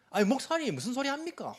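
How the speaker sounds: high-pitched imitation of upset congregant; mock angry protest. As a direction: exclaim in pretend outrage, pitch jumping up. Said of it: "화를 내면서".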